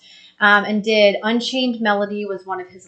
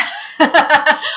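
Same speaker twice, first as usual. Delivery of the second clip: high, loud burst of laughter; real amused laugh; 6 semitones higher, 4 dB louder than usual. Real, not acted. Laughs at chat joke.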